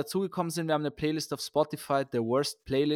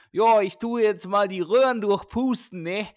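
hammed-up Viennese accent, higher and louder; thick Viennese accent; 8 semitones higher, 4 dB louder than usual. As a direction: play a chatty Viennese plumber-doctor, pitched high. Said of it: "switched into a thick Viennese accent".

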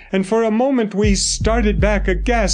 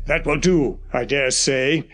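lower, measured doctor's voice; grave old doctor; 5 semitones lower than usual. A narrator's reading. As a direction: speak as the stern family doctor. Doctor speaks.

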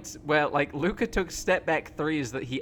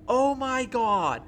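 high, drawn-out yell, louder than usual; retold yelling; 8 semitones higher, 7 dB louder, much slower than usual. Fan recalls jumping off the couch at the shot, yelling. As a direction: re-enact a stunned fan yelling at the winning shot. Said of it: "just started yelling".

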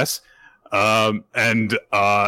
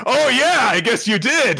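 much higher, dopey agreement; gullible lackeys; 4 dB louder than usual. Retold or imitated. Crew agreeing with Damien, high dopey voice. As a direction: agree like easily fooled sidekicks. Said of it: "And they're like".

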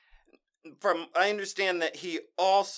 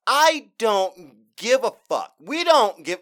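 emphatic; 4 semitones higher, 10 dB louder, slower than usual. Real, not acted. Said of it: "I'm an old man".

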